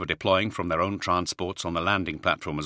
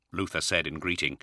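ordinary delivery

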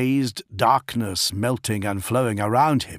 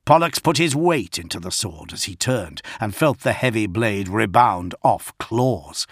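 ordinary delivery, nothing marked

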